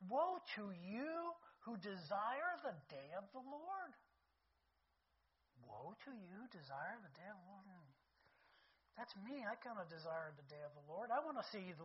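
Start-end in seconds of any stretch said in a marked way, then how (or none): 0.1-3.9 slow, weighty proclamation of Woe; slow scripture reading. Shown as a demonstration. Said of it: "he says". 5.7-7.9 much softer, hushed echo of Woe to you; quiet repeat. Shown as a demonstration. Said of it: "he says".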